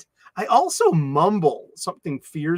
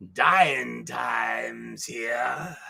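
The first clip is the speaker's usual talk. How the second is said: low, slow, breathy growl of doom; mock ominous rasp; gravel movie-villain growl; 6 semitones lower, 5 dB quieter, much slower than usual. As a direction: drag it out like a wasteland warlord. Growled movie-style line.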